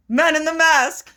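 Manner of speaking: slow, high-pitched mock British accent; bad British accent. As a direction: put on a deliberately terrible British accent. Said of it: "just a shit British accent".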